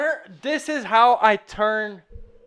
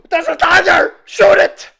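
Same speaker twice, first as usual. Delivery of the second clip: excited shout; 8 semitones higher, 9 dB louder than usual. Acted. Acting out a panicked tank crew.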